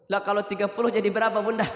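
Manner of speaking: normal talking voice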